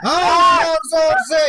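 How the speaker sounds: loud, high, forceful shout; urgent shouted command. Real, not acted. Exorcist urging helpers to grip harder, shouting.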